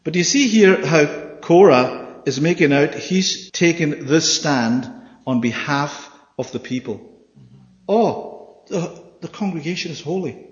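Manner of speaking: ordinary delivery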